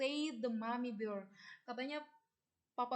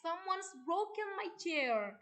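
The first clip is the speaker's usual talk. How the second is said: put-on a touch of a tearful baby bear; lightly voiced baby bear, upset; crying baby bear; 6 semitones higher than usual. Teacher reads the baby bear's cry to her pupils, mildly tearful. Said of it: "cried the baby bear".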